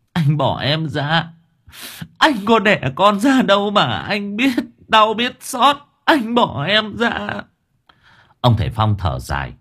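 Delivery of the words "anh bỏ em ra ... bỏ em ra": high, drawn-out tearful wail; sobbing protest. A narrator's reading. Mother begs husband to let go, high sobbing wail. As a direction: wail in anguish, pitched way up. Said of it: "cô Vân đang khóc nức nở".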